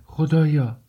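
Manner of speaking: high-pitched exclamation of shock; crying out. A narrator's reading. Mother appalled at her son's demand, crying out. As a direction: exclaim it high, a contained cry. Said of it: "پولچریا فریاد زد".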